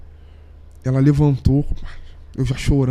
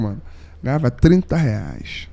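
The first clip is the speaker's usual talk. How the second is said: ordinary talk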